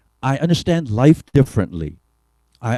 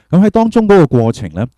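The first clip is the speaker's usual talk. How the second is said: louder, higher-pitched restart; raised voice; 6 semitones higher, 10 dB louder, faster than usual. Real, not acted. Speaker restarting after a volume check, raised voice. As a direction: lift your voice to be heard. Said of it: "我而家較大一少少".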